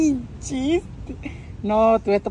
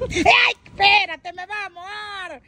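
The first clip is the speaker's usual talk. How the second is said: high startled squeal, slowed; excited squeal; 8 semitones higher, slower than usual. Real, not acted. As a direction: squeal as juice sprays. Recoiling from squirting coconut. (exclamación aguda y asustada)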